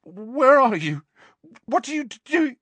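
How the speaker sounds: frightened, high-pitched questioning; Dudley. A narrator's reading. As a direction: panicky high whimper. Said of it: "Dudley whimpered".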